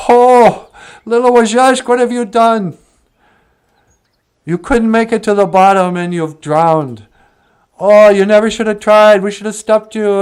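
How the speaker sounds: high, loud wailing grief; keening, hammed-up sorrow; acted crying lament. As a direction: cry out in mourning for a lost friend. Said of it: "they started to cry".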